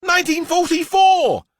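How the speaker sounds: put-on high, loud cheeky little-girl answer; cheeky Miranda. A narrator's reading. Little girl answering the teacher's history question, cheekily. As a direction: play it as a funny wrong answer. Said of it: "she said".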